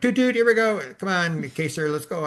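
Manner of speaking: put-on high sing-out to a small child, easing down; rally a little kid for another lap; playful toot-toot. Grandpa turns back to grandson's bike game, playful.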